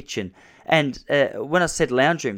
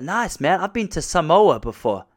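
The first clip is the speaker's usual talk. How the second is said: American dude; 7 semitones higher, 5 dB louder, slower than usual. Retold or imitated. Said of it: "He's like".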